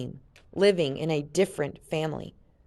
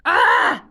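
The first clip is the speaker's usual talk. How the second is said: high, loud scream of frustration; frustrated scream; 10 semitones higher, 11 dB louder than usual. Acted. Jen screams in frustration at the screen. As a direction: let out a sharp cry of frustration. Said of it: "she screamed with a grimace of frustration".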